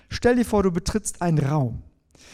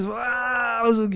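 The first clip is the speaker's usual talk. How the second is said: higher, mock panicked gasp; imitated panic; 5 semitones higher, slower than usual. Preacher shows the congregation a fright reaction, mock panic. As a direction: act out a startled fright, lightly. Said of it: "so von wegen Panik".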